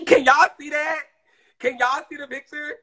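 high, loud startled gasp and question; sharp gasp, then high alarmed question; surprised gasp. Real, not acted. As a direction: gasp as the photos pop up. Surprised pictures popped up.

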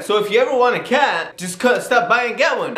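high-pitched imitation of a cat owner; imitated cat owner. Cat owner offering him a cat, imitated high voice. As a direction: pitch it well up, as her voice. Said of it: "She's like".

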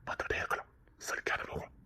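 soft unvoiced whisper of praise; hushed loving whisper; tender whisper. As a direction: whisper it lovingly into her ear.

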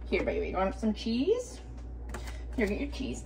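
quiet, hushed coaxing; soft pet talk. Real, not acted. Quietly coaxing her cat to take some cheese. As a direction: murmur it gently to the cat.

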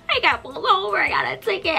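high, whiny imitated crying; mock bawling. Teen telling friends about the ticket, mock bawling. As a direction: blubber it like a tantruming toddler, play-acted. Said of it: "I was bawling my eyes out".

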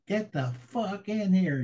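high, slow mock yell through the door; retold furious scream. Angry officer yelling a lieutenant in, a high, slow mock yell. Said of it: "this just ungodly screaming".

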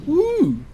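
high-pitched "woo"; high whoop. Real, not acted. Player whooping after a passing trash man, high whoop.

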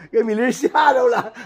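high, loud laughing words. Real, not acted. Laughing as the cat startles.

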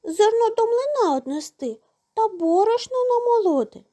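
high squeaky refusal; tiny mouse shrugging it off. A narrator's reading. Mouse refuses the rooster's request, high squeak.

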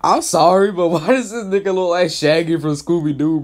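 ordinary talk

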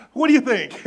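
eager question. Retold or imitated. Lucas asking studio executives, eager and loud. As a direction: lean in, dying for a yes. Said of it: "he's so excited".

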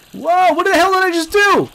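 high, loud startled yelp; shocked yell. Real, not acted. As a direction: blurt it out in sudden alarm. Shocked at own goose mischief.

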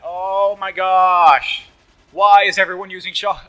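fed-up gamer outburst; exasperated yell. Real, not acted. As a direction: cry out in disbelief, dragging the words. Exasperated at others' weapons.

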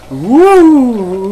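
long high whooping "woo"; big drawn-out cheer; hyped cheer. Acted. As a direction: let out a loud, high show-opening whoop. Hyped cheer to open the show.

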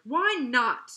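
loud, indignant outburst; sharp, stretched, exasperated demand. A narrator's reading. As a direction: demand an answer, voice jumping up. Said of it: "demanded Florrie".

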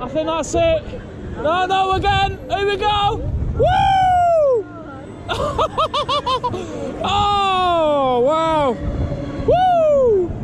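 high whoops and laughs mid-ride; thrilled shouting; on a roller coaster. Real, not acted.

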